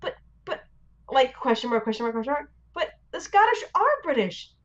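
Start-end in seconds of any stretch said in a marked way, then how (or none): 0.0-0.6 whispered, stammering but but; imitating the puzzled wife; confused whisper. Wife's confused reply. 2.8-4.5 high pitched mock of the wife's correction; imitating the wife gently correcting her; puzzled correction.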